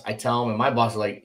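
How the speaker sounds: ordinary talk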